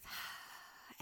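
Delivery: long soft breathy sigh; gentle releasing exhale; exhale through mouth. Shown as a demonstration. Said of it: "out through the mouth".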